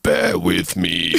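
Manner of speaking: slow, low caveman grunt; lightly voiced dim caveman. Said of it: "I hadn't practiced my caveman voice".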